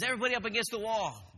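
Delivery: high, loud barked order; yelling drill instructor. Retold or imitated. Drill instructor orders new recruits to line up, yelling. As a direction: bark it out to the whole room. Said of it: "drill instructor's still yelling at you".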